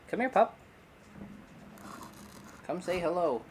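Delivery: high sing-song call to a pup; dog-calling voice. Real, not acted. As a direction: coax the puppy over.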